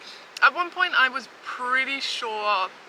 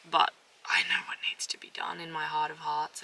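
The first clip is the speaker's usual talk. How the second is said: breathy whisper to herself; 4 semitones lower, 8 dB quieter than usual. Real, not acted. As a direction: whisper it like a secret. Confessing resolve to herself.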